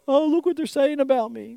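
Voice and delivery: high-pitched, hammed-up dismay; mock worried whine; mock fretting. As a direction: pretend to be confused and hurt. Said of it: "confused. He doesn't go there going".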